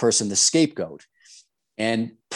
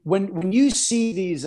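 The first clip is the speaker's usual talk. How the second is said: drowned in static; 7 semitones higher than usual. Real, not acted. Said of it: "You're breaking up a little bit".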